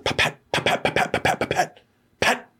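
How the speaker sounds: soft unvoiced pat-pat gunfire sounds; mock gunshots. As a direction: tap out quiet gunshots with the breath. Imitating gunfire seen through window.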